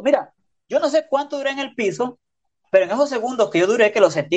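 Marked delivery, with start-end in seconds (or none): none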